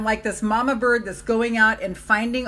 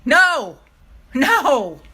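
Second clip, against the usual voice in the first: much higher and louder shout; shouted shut up; 7 semitones higher, 8 dB louder, much slower than usual. Pretend. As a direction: yell to silence the doubts. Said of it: "It's like".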